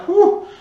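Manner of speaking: high, loud "whew"; exclaimed whew. Acted. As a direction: let out a big, high whoop of amazement. Relief at so many scooters moved.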